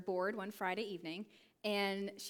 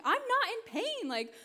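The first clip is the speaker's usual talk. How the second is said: amazed disbelief; 10 semitones higher, 5 dB louder than usual. Retold or imitated. Healed man to the girls, high wondering cry. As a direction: marvel that the pain is gone.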